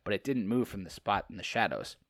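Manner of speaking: ordinary talk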